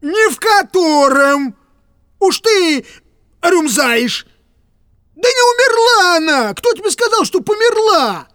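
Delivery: exasperated. Acted. Piskarev denies, exasperated.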